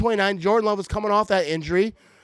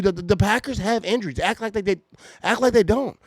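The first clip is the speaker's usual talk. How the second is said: normal talking voice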